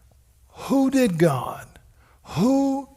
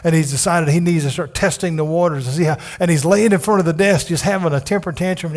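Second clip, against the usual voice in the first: ordinary talk, nothing marked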